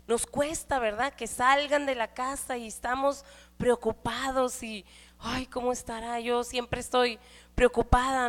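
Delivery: ordinary talk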